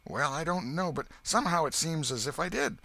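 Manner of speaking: put-on a breathless ragged country boy; Huck the boy. A narrator's reading.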